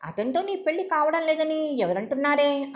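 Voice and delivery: who said నీ పెళ్లి isn't happening, demanding; incredulous. Acted. Sister challenging her conclusion. (ఆశ్చర్యంగా నిలదీస్తూ)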